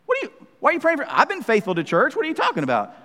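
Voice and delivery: high-pitched, quick indignant protest; mock affronted churchgoer. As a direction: act offended and self-righteous. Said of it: "this holier-than-thou attitude".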